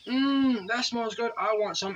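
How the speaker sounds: delighted mom. Retold or imitated. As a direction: imitate mom suddenly happy at the smell of food. Mom smelling fish wakes up.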